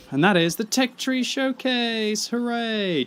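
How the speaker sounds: big, slow, high showman announce; hyped announcement. Acted. Host hyping viewers for the showcase, grand announcer voice. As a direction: present it like a game-show host. Hyping the video's main segment.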